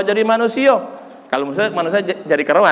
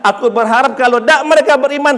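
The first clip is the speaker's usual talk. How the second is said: raised, mounting sermon delivery; impassioned preaching; 5 semitones higher, 3 dB louder than usual. Real, not acted. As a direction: proclaim the Prophet's forgiveness with force. Preaches at full force. (khotbah lantang penuh semangat)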